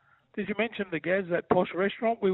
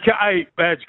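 calling out; 8 dB louder than usual. Real, not acted. Calling out to mate on air.

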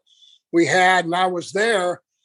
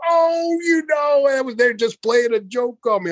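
higher, flustered excuse that settles down; flustered Brian; 5 semitones higher than usual. Retold or imitated. Friend imitating Brian caught with the silverware, flustered high voice. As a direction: squeak out a guilty excuse. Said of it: "And Brian's going".

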